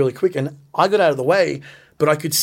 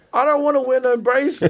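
high, whining put-on voice; whiny mock protest; 10 semitones higher than usual. Recalling rumours he faked his injury, whiny high mock voice. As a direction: whine it like a sulky kid. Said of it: "I was like".

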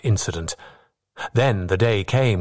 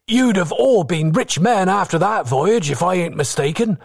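put-on high-pitched, eager young seaman; admiring young sailor; 9 semitones higher, 3 dB louder than usual. A narrator's reading. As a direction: marvel at the old pirate's tale, pitched well up. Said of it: "he sounded full of admiration".

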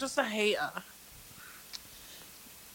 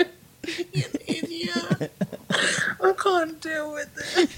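high, breaking into laughter; words through helpless laughter; laughing while talking; 9 semitones higher than usual. Real, not acted. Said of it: "Stop making me laugh".

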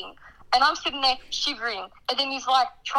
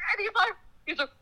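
ordinary talk, nothing marked

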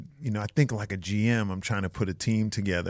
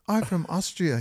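higher, light mock woman's reply; lightly voiced woman; 8 semitones higher than usual. The woman in the movie answering, lightly voiced and higher. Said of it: "She goes".

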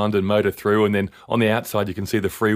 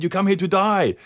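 higher mock Japanese guy doing an Aussie accent; imitating Japanese man's Aussie accent; 11 semitones higher than usual. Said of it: "his play on our accent".

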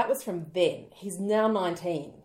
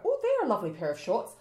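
mock price shock; 6 semitones higher, 7 dB louder than usual. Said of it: "you go".

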